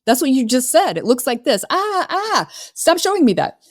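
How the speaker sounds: high, eager yes, then a squealed ah ah; excited reflection. Retold or imitated. Consultant mirroring the client's dream back, excited. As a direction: bounce their dream back to them with glee. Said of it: "it's like".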